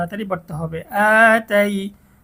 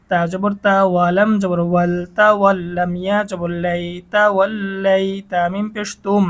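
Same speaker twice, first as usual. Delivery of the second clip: usual speaking voice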